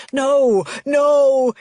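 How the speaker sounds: repeated no growing into a scream; rising shout. Acted. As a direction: build the no's into a full panicked yell. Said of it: "he yells again".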